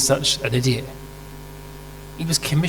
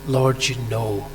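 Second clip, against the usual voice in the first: tender reply; 3 semitones lower, 6 dB quieter than usual. Retold or imitated. Peter's answer, slow and soft.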